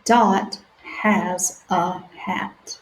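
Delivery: stretched-out sounding out; slow sounding out. Shown as a demonstration. Teacher reading slowly with children. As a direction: read each word slowly for beginners. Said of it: "let's read it one more time".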